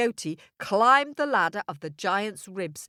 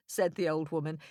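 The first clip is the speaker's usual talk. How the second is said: usual speaking voice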